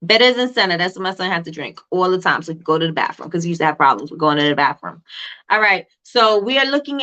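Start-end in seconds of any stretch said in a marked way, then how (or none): 0.1-0.8 fake Jamaican accent. Teacher joking to her live chat in a fake Jamaican accent. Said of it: "And my fake Jamaican voice".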